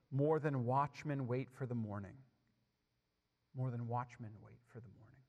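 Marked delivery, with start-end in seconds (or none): 3.5-5.2 softer, lower repeat of the verse; hushed repeat. Acted. Preacher repeats a psalm verse to the congregation, hushed. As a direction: let the repeated verse sink in, hushed and low.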